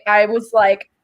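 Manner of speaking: usual speaking voice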